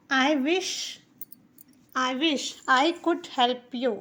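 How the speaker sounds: "I wish I could help you" very slow, word by word; slow model sentence for beginners; slow teaching read. Shown as a demonstration. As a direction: dictation pace for learners. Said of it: "उसकी English होती है".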